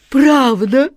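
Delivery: joyful high shout; overjoyed. Acted. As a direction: leap with delight. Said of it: "Джин подпрыгнул от радости".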